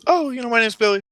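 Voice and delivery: put-on high, eager kid voice; Billy. Young volunteer answering the clown, eager. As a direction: play an excited little boy introducing himself. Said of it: "And Billy goes".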